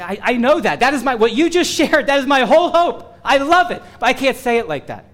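excited, with a laugh in the middle; eager listener's reply. Retold or imitated. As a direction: act out a believer who loves it but can't explain it. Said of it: "you say".

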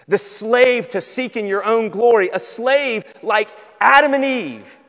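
high, loud pulpit force; forceful preaching. Real, not acted. Preacher naming the slavery of self-glory, forceful. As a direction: hammer the key words loudly.

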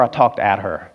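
ordinary talk, nothing marked

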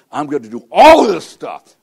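loud, high burst on ALL; exasperated, booming promise; overwhelmed vow. Acted. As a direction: belt out "all" in mock determination. Piling up frantic promises.

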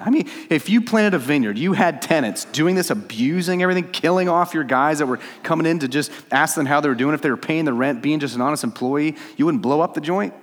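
heated, high and loud preaching; impassioned, incredulous tirade; indignant challenge. Real, not acted. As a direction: press the listeners with rising outrage. Challenges listeners' sense of outrage.

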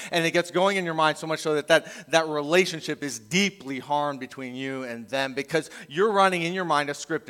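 normal talking voice

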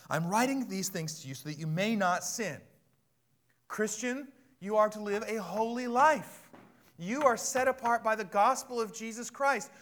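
high, forceful pulpit delivery; full-force preaching. Real, not acted. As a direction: preach it out to the whole church.

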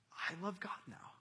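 hushed, awed little confession; soft, simple whisper; whispered child's response. Acted. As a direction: whisper it like a child newly believing. Child's response to Sunday school teacher.

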